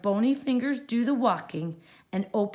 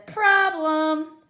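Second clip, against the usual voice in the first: high, loud witch screech; 7 semitones higher, 7 dB louder than usual. A narrator's reading. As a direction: screech it like Gritch. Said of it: "screeched Gritch".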